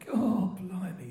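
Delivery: high, slow exclamation of delight; Len B impression. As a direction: imitate an old Croydon character marvelling, words stretched out. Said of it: "And he went".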